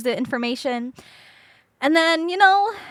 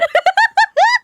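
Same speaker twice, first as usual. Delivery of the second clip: shrill burst of laughter; high crying laugh; high laughing squeal; 7 dB louder than usual. Real, not acted. Laughs at her own plea.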